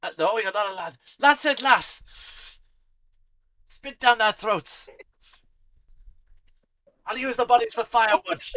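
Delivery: loud, high rowdy tavern keeper; spirited barkeep. Acted. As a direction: bellow with bloodthirsty glee. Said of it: "she's rather spirited".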